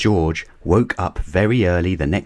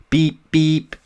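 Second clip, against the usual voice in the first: car horn beep; 10 semitones higher, 3 dB louder than usual. Acted.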